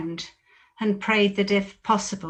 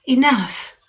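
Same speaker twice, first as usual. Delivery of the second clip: a loud, higher cry of enough; sharp command; 5 semitones higher, 5 dB louder than usual. Acted. Jesus ending the disciples' sleep, sharp loud command. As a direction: cut them off firmly and loudly.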